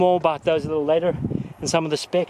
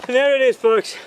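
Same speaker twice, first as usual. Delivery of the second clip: panting after the climb; breathless from the mountain hike; out of breath; 7 semitones higher, 4 dB louder than usual. Real, not acted. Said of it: "a little out of breath".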